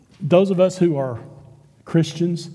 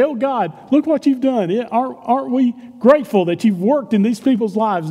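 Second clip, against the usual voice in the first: imagined grateful praise; 7 semitones higher than usual. Pretend. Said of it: "you would think he would stand up and say".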